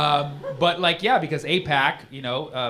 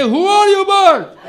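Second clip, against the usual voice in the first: very high, loud, slow squeaky bear; shrieking cartoon bear demanding; 13 dB louder, much slower than usual. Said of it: "Bear say".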